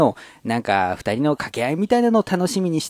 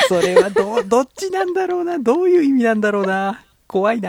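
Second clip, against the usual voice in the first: mock worried; 8 semitones higher than usual. Real, not acted. Mock worry about dark song.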